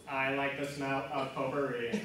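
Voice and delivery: low robotic monotone; deadpan canned robot phrase. Acted. Android answers sniffing dog, robot voice. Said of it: "record them in a robot voice".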